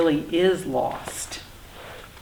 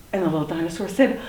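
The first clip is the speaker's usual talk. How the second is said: ordinary delivery, nothing marked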